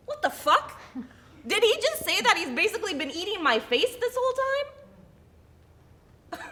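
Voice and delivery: high, loud, incredulous outburst; outraged disbelief. Acted. Wife reacting to her husband's excuse, loudly incredulous. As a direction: react in shock, pitch leaping up.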